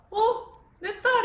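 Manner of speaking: high, loud hammed-up gasp of disbelief; mock shocked outcry. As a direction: imitate a father crying out in shock. Acting out father's shocked reaction.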